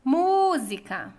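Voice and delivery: "Música" called loud, MÚ held long; stress on MÚ. Shown as a demonstration. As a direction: stretch a strong MÚ in "Música". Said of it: "A sílaba tônica é mu". (chamando "Música", com o MÚ forte e alongado)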